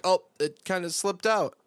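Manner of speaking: high, sheepish mock voice; sheepish admission. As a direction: own up like it just slipped out. Character covers mouth, confessing.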